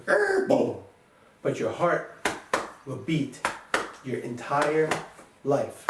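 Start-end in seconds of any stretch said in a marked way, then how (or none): 0.0-0.8 imitated engine dying. Shown as a demonstration. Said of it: "the engine will conk out".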